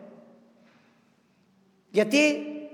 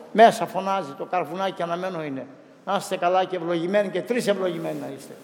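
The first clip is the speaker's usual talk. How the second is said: ordinary delivery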